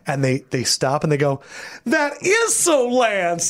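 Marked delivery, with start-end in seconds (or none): 1.9-3.5 high, slow delighted echo; imitating a delighted echo. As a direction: savor it like an inside joke.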